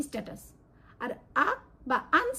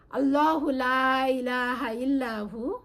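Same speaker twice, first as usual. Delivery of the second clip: slow, drawn-out Quranic chant; Quran recitation; much slower than usual. Shown as a demonstration. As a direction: recite it slowly like tilawat. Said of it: "যেমন কোরআনে আছে". (ধীর কুরআন তিলাওয়াত)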